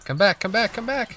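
high pitched calling after someone. Real, not acted. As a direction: yell after the runaway. Calling after a fleeing enemy.

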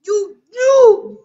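high, loud desperate shouts; shouting no. Real, not acted. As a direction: yell no in despair. Being killed, shouting.

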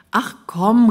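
loud call, rising on komm. A narrator's reading. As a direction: call it out to him loudly. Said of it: "rief Norbert". (laut rufend)

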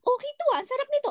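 high, bright retold delight; delighted praise. Guest praising her friend's boiled egg, delighted. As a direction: gush over the snack like a happy guest. Praising mother's simple snack.

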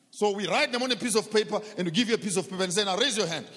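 loud, high, driving sermon voice; rising pulpit fervour; fiery preaching. Acted. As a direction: preach it hard to the congregation. Preaching at full force.

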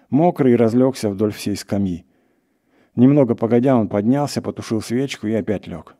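ordinary delivery, nothing marked

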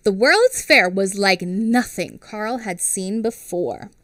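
ordinary delivery

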